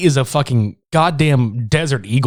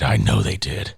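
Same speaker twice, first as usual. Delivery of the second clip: low, suspicious detective voice; deep cop voice; 4 dB quieter than usual. Retold or imitated. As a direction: say it like a gruff cop. Voicing the cops.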